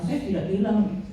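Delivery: ordinary talk, nothing marked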